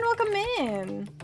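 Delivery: ordinary delivery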